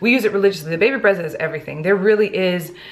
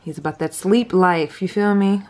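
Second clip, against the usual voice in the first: ordinary talk, nothing marked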